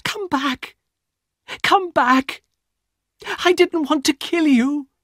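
a woman crying out in horror after her brother; Elena's desperate cry. Acted. Elena calls after dying brother.

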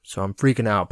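normal talking voice

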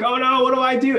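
high, hammed-up little panic; mock panic. Preacher mocking his own worry, high and fretful. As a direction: squeak it out like you're overwhelmed. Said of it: "I'm like".

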